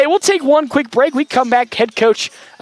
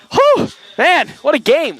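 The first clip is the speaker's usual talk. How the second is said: excited; 5 semitones higher than usual. Real, not acted. Thrilled announcer after big win.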